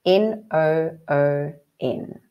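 slow, sing-song letter naming; slow spelling. Shown as a demonstration. Teacher spells the word for the class, slow and sing-song. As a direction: spell out the letters of afternoon slowly, one by one. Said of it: "How do we spell afternoon?".

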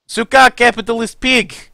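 mock Russian insult. Soldier cursing the capitalist enemy, hammed-up angry. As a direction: spit the insult in a mock accent.

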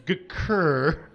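ordinary talk, nothing marked

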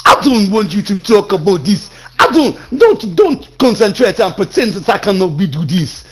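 hammed-up, emphatic African accent. Asked for an African accent, boasting he can talk anywhere, African accent. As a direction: lay down the law in a mock African accent. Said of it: "Go on, do an African".

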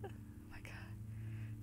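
scared whisper. Real, not acted. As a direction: breathe it out in fear.

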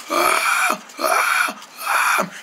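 voiceless breathy strain, no sound comes; lost voice. Acted. King straining to speak after losing his voice, voiceless. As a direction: try to speak with no voice. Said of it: "He can't talk".

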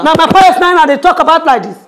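fast, loud, high outburst; angry scolding. Acted. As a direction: scold the mockers at speed. Scolds the mockers.

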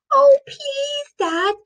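very high, drawn-out plea; pleading child. A narrator's reading. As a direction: beg Dad like a little girl. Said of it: "pleaded Zoe".

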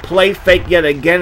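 ordinary talk

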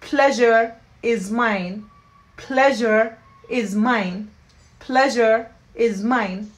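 slow reply with pleasure stressed; slow teaching model. Shown as a demonstration. Teacher drilling the polite reply for learners. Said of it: "So بنجاوب".

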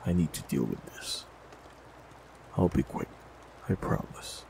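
usual speaking voice

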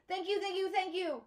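high, squealing thanks; excited squeal. A narrator's reading. Girl thanking her father, squealing with joy. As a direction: squeal with delight. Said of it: "I squeal".

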